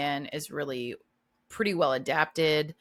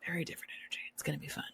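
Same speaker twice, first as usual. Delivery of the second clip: breathy whispered echo of the line; hushed teasing whisper; 14 dB quieter, much faster than usual. Acted. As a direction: drop to a stage whisper for effect. Repeats tagline, then whispers it.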